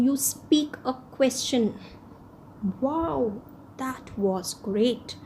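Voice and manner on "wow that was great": a slow, drawn-out exclamation of admiration; exclamation demo. Shown as a demonstration. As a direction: show amazement with a stretched-out wow. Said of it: "that was an exclamation".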